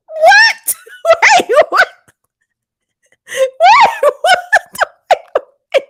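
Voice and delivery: very high, loud squeal breaking into laughter; shocked disbelief. Real, not acted. Host floored by gossip from viewers, squealing disbelief. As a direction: shriek it, stunned and cracking up.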